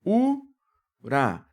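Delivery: "Ura" split into u and ra; syllable by syllable. Shown as a demonstration. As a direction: pause between "u" and "ra". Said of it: "vou separar a sílaba".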